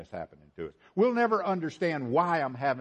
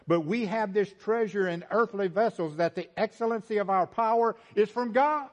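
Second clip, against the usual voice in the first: high, raised preaching voice; impassioned preaching; 8 semitones higher, 3 dB louder than usual. Real, not acted. Preacher urging congregation to depend on God, impassioned. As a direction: drive it home from the pulpit.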